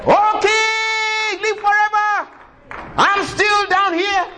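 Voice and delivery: calling out. A narrator's reading. Daniel answering the king from the den, high call. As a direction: shout up cheerfully from the pit.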